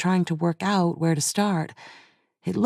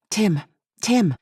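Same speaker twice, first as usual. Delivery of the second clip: snapping; 3 semitones higher than usual. A narrator's reading. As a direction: snap the name out in alarm. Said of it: "she snapped into her earpiece".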